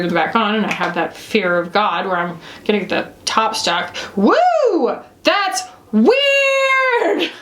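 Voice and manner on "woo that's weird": high-pitched amazed squeal; high, drawn-out exclamation of wonder; startled whoop. Real, not acted. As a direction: whoop in surprise at something weird. Startled by squishy product texture.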